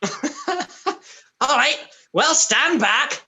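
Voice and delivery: high, loud, drawn-out announcement; showman gift-giver. Acted. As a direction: warn the crowd with a flourish. Gift-giver figure announces his magic trick.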